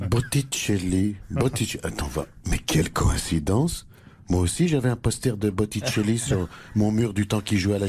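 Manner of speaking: Nicolas Sarkozy. Acted. Husband recalls his own Botticelli poster, low caricatured politician voice. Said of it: "l'ancien président Nicolas Sarkozy".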